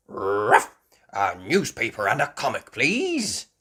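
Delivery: loud bark, then doggy order. Acted. Grandad Dog ordering from the boat, barking dog voice. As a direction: bark first, then speak up.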